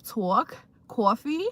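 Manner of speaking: mock New York "talk, coffee" with aw vowels; playful New York-area vowels, rising on coffee; joke New York accent. Shown as a demonstration. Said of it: "we used to talk like that as a joke".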